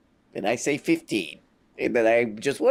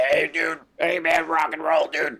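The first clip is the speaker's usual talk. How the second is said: throaty radio host impression, lightly done; pure throat voice; 4 semitones higher than usual. Imitating the host's throaty voice, lightly done. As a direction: squeeze it out of the throat like a rock DJ. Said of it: "It's just pure throat or something".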